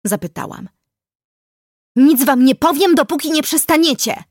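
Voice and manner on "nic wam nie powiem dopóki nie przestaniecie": loud, high, stubborn shout; girl yelling, refusing to give in; called-out defiance. A narrator's reading. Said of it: "zawołała i zacisnęła zęby".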